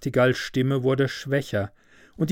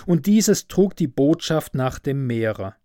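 ordinary delivery, nothing marked

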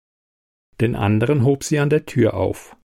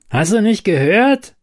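high, loud angry snap; snapping; 9 semitones higher, 4 dB louder than usual. A narrator's reading. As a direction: snap at her. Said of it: "blaffte er".

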